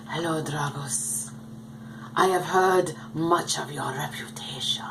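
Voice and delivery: put-on breathy, whispery doll voice with a muddled foreign accent; [name]'s bad foreign accent. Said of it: "in that accent".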